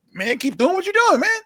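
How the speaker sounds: high, breezy put-on boss voice; mock bosses' praise. Bosses brushing off the wrestler, high breezy mock voice. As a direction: toss it off like empty praise.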